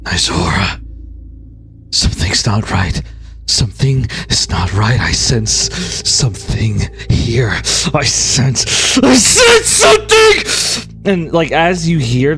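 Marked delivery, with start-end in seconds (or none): 0.0-9.0 low, uneasy inner voice; whispering goddess. Acted. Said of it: "The goddess Sin whispers". 9.0-10.4 high, loud panicked cry; panicked shout. Acted. Goddess panicking in Vera's head.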